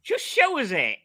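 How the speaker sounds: high, loud insistence; impatient demand. Real, not acted. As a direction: demand it loudly, fed up.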